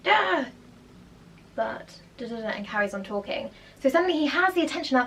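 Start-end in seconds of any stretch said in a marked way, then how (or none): 0.0-0.4 imitated shout. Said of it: "So he went".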